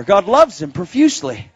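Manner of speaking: loud, triumphant pulpit proclamation; emphatic preaching. Real, not acted.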